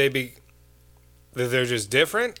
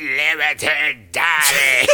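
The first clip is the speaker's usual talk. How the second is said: slow, stretched alien daddy; mock villain drawl; hammed-up alien overlord; Krang voice; 3 semitones higher, 4 dB louder, much slower than usual. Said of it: "became a little more Krang".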